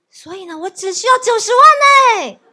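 loud, high, delighted with laughter; excited laughing quote. Retold or imitated. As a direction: retell the pastor's glee. Pastor happily reports on fundraising.

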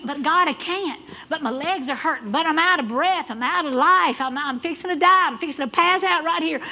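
a loud, high mock whine of an exhausted runner; complaining runner. Runner telling God his legs give out, mock-whining loudly. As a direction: play the worn-out runner begging God to stop.

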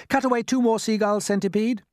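lightly voiced high command; James giving orders. A narrator's reading. James directs the centipede.

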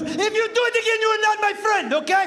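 very high, loud squawked impression; mock scolding. Warning a drunk friend, high mock scolding. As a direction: scold in a put-on high squeal.

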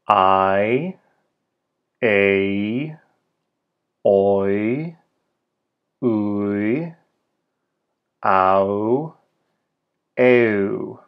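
each diphthong drawn out as one slow glide; isolated vowel glides, "I, a, oi, ui, au, eu", held long; diphthong demo. Shown as a demonstration. Teacher modelling the six Esperanto diphthongs, slow demo. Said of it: "There are six diphthongs you'll encounter".

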